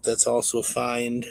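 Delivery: ordinary talk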